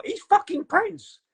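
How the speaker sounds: high-pitched, incredulous ranting; mock screaming. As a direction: protest shrilly at the TV in disbelief. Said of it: "I'm screaming at the T V".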